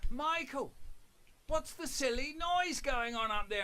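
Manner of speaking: high, loud call from downstairs; mum shouting up. Retold or imitated. Mum shouts up the stairs at her noisy son, high loud call. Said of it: "my mum from downstairs going".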